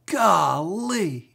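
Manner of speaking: boy blurting out a word, mock yell. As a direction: blurt it out in class. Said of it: "I just yelled out".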